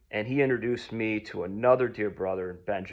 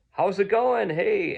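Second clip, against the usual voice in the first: lightly voiced upbeat greeter, pitched up high; poem guy's voice; 10 semitones higher, 5 dB louder, slower than usual. Man greets newcomer at the church, upbeat and high. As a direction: lift it into a louder, friendly hello. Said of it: "he had such a distinct voice".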